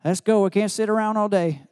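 loud, high imitation of a Southern grandfather hurrying everyone along; mock an old Tennessee man urging them out the door; hammed-up Southern grandpa, loud and high. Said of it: "He had a deep Southern accent".

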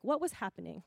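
soft, low, open question; non-accusing question. Shown as a demonstration. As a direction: ask gently without blame. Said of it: "Versus, like".

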